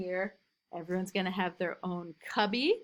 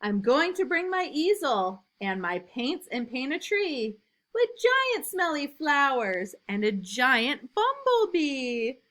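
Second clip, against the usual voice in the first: lively, louder storybook reading; reading to children; 5 semitones higher, 5 dB louder, slower than usual. A narrator's reading. Teacher reading a picture book to new kindergartners, lively and loud. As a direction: read it big and bouncy for little kids.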